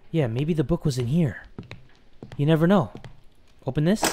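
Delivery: unvoiced whisper to self. Real, not acted. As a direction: whisper it like sneaking. Searching quietly in horror game.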